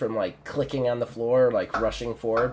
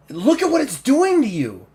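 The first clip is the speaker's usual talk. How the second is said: high, loud desperate plea; raised-voice pleading; distressed outburst; 13 semitones higher, 7 dB louder than usual. Acted. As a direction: cry out to him in alarm. Woman pleading in acted quarrel.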